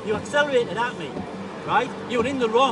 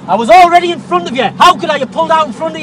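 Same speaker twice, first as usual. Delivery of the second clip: loud, indignant shout; shouting back; 3 semitones higher, 10 dB louder than usual. Real, not acted. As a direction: shout him down, insisting you were first. Driver shouting back in argument.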